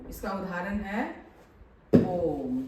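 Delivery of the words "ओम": ओम drawn out, low and loud; prolonged vowel. Shown as a demonstration. Teacher repeating the extra-long vowel example, drawn out. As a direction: hold the O of ओम, then hum the m. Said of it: "दीर्घ स्वर के उच्चारण से भी अधिक समय".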